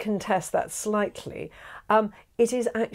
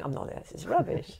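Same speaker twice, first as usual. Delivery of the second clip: mock critic; 7 dB quieter than usual. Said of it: "people going".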